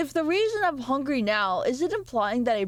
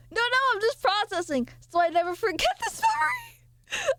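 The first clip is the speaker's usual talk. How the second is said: squeaky high-pitched voice; 12 semitones higher than usual. Pretend. High silly voiced aside.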